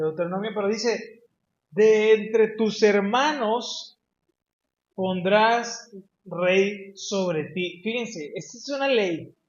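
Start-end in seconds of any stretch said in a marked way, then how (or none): 1.7-7.8 very slow, drawn-out scripture reading; stretched, deliberate pulpit reading; slow solemn reading. Acted. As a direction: weigh every word of the law. Reads law verse with emphasis.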